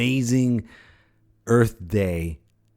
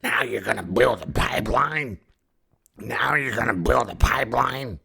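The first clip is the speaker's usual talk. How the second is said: raspy, indignant Mother Earth; hammed-up raspy grievance; Nick Nolte. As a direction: demand it in a gruff, worn-out rasp. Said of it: "Does Mother Earth sound like Nick Nolte?".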